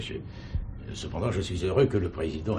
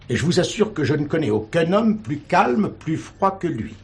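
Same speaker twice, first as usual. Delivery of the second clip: higher and louder, pressing each plus; earnest insistence; 10 semitones higher, 5 dB louder than usual. Acted. Chaplain defends the prisoner to the commissaire, earnest insistence. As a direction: insist hard, slowing on plus froid.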